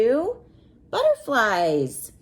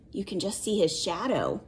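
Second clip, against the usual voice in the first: usual speaking voice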